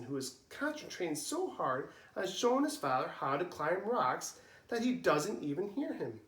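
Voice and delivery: usual speaking voice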